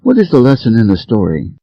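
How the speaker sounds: ordinary delivery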